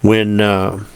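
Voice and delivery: usual speaking voice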